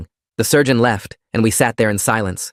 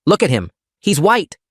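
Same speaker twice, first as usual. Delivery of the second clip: panicked; 8 semitones higher than usual. Acted. Sister panicking over brother.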